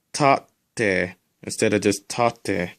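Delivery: tate first with a held double t, then tate with a single t, slowly; slow contrast demo. Shown as a demonstration. Teacher contrasts doubled and single t in tate, slowly. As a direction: hold the t in the first tate, not in the second. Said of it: "So it's kinda like this".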